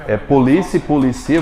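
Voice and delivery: "polícia" com tônica em LÍ, "policia" com tônica em CI; tonicidade contrastada. Shown as a demonstration. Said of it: "a posição da sílaba tônica". (tônica trocada entre "polícia" e "policia")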